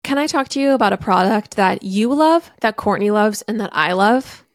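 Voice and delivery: loud, upbeat sponsor read. Acted. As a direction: sell it like a podcast ad.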